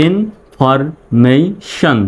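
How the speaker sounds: Information stretched very slowly with gaps; syllable by syllable. Shown as a demonstration. Teacher putting the spelled parts together, syllable by syllable. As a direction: say information as four spaced syllables. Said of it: "তাহলে উচ্চারণটা কেমন হচ্ছে?".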